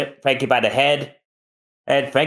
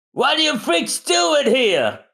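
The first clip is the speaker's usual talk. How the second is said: high, brash Frankie voice; slower than usual. Acted. Frankie suspects Zombie's men at door, brash.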